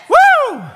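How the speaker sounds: high loud woo; joyful whoop. Real, not acted. Preacher whooping for joy at the congregation.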